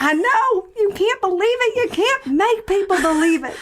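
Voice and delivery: high-pitched hammed-up Southern belle; Southern belle accent. Improvising an abduction witness with a co-host, Southern accent. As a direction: play an astonished Southern witness. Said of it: "our Southern Belle accents".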